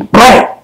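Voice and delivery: sudden, high-pitched impact word; imitated bang. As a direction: punch it out as a sound effect. Sound effect for a sudden hit.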